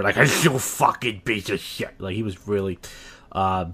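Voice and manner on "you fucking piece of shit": put-on slow, wet drunken slur; mock slobbery drunk insult; slobbering drunk imitation. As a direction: drag it out like a wasted caller. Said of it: "'cause he sounded like slobber".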